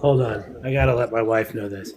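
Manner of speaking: ordinary delivery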